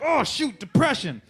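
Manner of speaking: high-pitched dismayed cry; mock alarm. Player reacts as the dice games crash, mock alarm. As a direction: blurt it out high, playing dismay.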